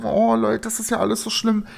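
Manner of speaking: high, hammed-up lament; gespieltes Jammern. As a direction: wail lightly to your audience. Mock-wailing to viewers about breakdown. (gespielt jammernd, mit hoher Stimme)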